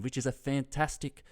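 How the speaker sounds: usual speaking voice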